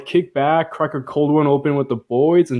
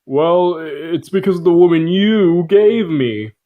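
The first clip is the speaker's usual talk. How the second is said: whiny Adam; 5 semitones higher, 4 dB louder than usual. Retold or imitated. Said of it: "Adam's all like".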